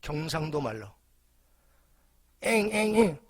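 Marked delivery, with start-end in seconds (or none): 2.4-3.2 a child's imitated whining sob, a bit louder; toddler's pretend wail, lightly done; mock whimpering cry. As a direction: playfully fake the little boy's crying. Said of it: "울면서 엥엥엥 울면서".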